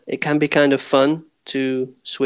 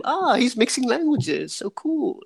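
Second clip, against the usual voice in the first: high-pitched, hammed-up awe; mock admiring onlooker; 11 semitones higher, slower than usual. Imagined Dane admiring a learner who mixes languages, mock impressed. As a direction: gush in a much higher voice, as if hugely impressed. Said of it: "They will think you're cool".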